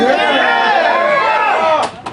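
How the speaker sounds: drawn-out high shout to the crowd; long shouted yeah; long hype shout. Real, not acted. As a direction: stretch it out and yell it.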